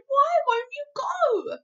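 high, loud, slow demand; pleading cry. A narrator's reading. Sophie pushing the giant to act, pleading cry. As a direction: draw out an exasperated plea. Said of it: "Sophie cried".

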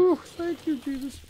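high delighted squeal; squealing relief. Real, not acted. Relieved at found ammo.

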